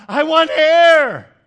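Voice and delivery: very high, very loud, slow desperate scream; the drowning man finally screams what he truly wants. Acted. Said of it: "the man screams out".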